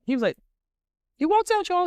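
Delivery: ordinary talk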